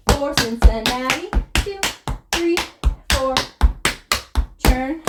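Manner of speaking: normal talking voice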